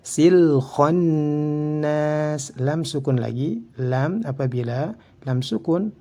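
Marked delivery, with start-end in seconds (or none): 0.0-2.5 very slow "Sil khonnas", l sounded, long hummed n; chanted "Sil khonnas", drawn out; clear lam. Shown as a demonstration. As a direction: recite "Sil khonnas" with distinct l and held nn. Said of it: "harus dibaca dengan".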